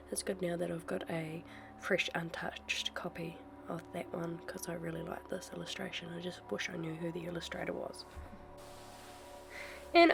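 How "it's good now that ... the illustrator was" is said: soft, half-whispered to herself; quiet aside. Real, not acted. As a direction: murmur it under your breath. Drops to near-whisper aside.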